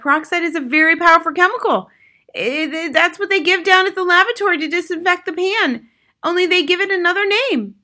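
high, loud lecturing mother. A narrator's reading. Mother explains peroxide's dangers, high and emphatic. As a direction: lecture your husband in a high, insistent voice.

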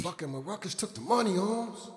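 very soft, far-off aside; barely voiced recalled thought; hushed inner thought. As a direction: drop right down as if thinking it. Recalled thought inside the story.

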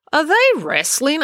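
put-on high, loud, curious girl; April's puzzled question. April asks about the writhing cockroaches, high and curious.

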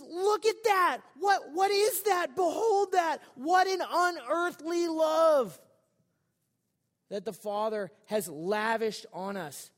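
high, loud, slow wonder that settles at the end; awestruck exclaiming. Retold or imitated. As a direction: point at it in amazement, then let it land. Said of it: "It's like he's, he's just".

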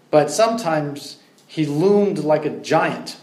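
ordinary talk, nothing marked

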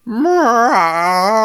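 loud, high, sung-out meow; a long wailing cat cry. A narrator's reading. Cat in the entryway crying at River, loud high meow. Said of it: "was a cat, saying".

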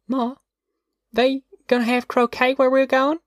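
put-on high-pitched little girl asking; small high child voice; little girl at bedtime. A narrator's reading. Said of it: "Ruthie whispered".

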